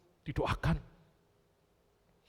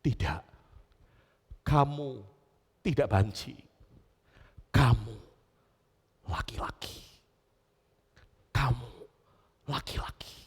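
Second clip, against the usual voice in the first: gentle; 6 semitones lower than usual. Acted. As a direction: speak tenderly, ending in a whisper. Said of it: "dengan lembut dan berkata".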